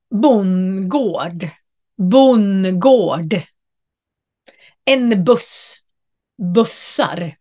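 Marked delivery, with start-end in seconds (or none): 0.1-3.4 "bondgård" as "bongård", the d dropped; contracted bondgård. Shown as a demonstration. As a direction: draw "bondgård" together as "bongård". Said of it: "utan det blir". ("bondgård" uttalat "bongård" utan d)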